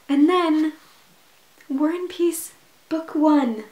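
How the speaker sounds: thrilled fanfare for War and Peace; excited announcement. Real, not acted. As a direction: announce it like a grand opening. Grand unveiling of book start.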